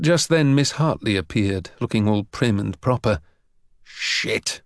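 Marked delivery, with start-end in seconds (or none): 3.8-4.6 whispered curse; hushed, voiceless expletive; under his breath. A narrator's reading. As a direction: swear softly so nobody hears. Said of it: "say under his breath".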